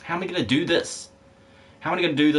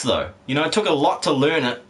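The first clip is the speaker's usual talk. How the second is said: usual speaking voice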